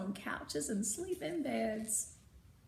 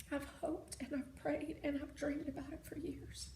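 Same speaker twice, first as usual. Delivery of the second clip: softer, whispered grief; hushed sorrow; 4 semitones higher, 9 dB quieter than usual. Acted. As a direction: confess it quietly. Father admits painful truth.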